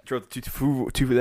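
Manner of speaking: stumbling over the number; tongue-tied. Real, not acted. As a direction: trip over your words and restart. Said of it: "I can't even talk".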